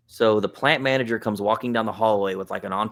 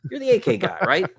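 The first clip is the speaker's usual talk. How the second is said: high, eager voice of the plant manager; thrilled to spot a fan favourite; excited lady; 10 semitones higher, 3 dB louder than usual. Retold or imitated. Plant manager recognizes him.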